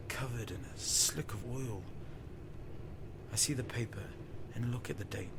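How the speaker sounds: soft, breathy storytelling whisper; hushed narration. A narrator's reading. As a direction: draw the listener in quietly. Hushed narration of strange place.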